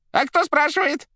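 loud, very high rattly voice; rattling telephone voice. A narrator's reading. The receiver questioning the caller, high and rattling. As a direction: ask like a jangling receiver. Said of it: "ответила трубка дребезжащим голосом".

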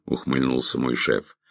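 plain reading voice

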